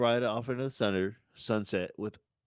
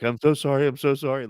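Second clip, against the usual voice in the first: louder, higher pleading apology; tearful apology; 6 semitones higher, 6 dB louder than usual. Retold or imitated. Clarence begging Alabama's forgiveness, raised pleading apology. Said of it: "and he's like".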